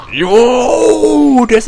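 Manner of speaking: high, loud whoop; triumphant shout. Real, not acted. Gamer cheering a successful move, a high loud whoop. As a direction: celebrate the win out loud.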